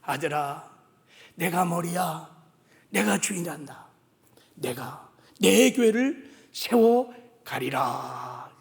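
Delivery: God speaking low and soft to his son. Retold or imitated. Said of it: "가르쳐 준 거예요". (낮고 부드럽게 타이르는 하나님 음성)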